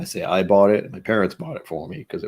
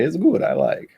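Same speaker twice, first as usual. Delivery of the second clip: "It's good" jumping high in a put-on Russian accent; hammed-up Russian accent on the catchphrase; playful fake Russian accent; bizarre Russian accent; 9 semitones higher than usual. Shown as a demonstration. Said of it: "with bizarre accent".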